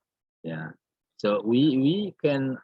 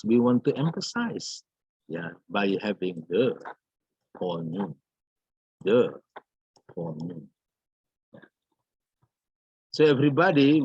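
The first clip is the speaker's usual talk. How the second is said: normal talking voice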